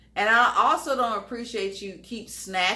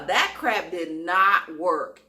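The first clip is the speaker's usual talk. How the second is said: normal talking voice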